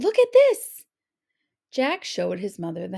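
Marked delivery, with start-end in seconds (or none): 0.0-0.8 high, loud and eager; excited boy. A narrator's reading. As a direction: show off the prize. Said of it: "Jack called".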